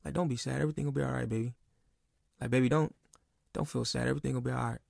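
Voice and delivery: soft, soothing reassurance; imitated motherly comfort, softly spoken. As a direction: gently console someone who is crying. Mother comforting his crying wife.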